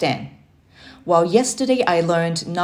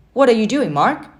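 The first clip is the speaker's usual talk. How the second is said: louder, higher disappointed question; 6 semitones higher, 5 dB louder than usual. A narrator's reading. Uncle finding nephew watching TV, disappointed. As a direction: let him down with the question. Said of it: "he asked with a disappointed tone".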